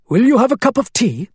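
put-on Arabic accent. A narrator's reading. Abu Hamad's voice.

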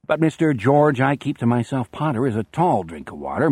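ordinary delivery

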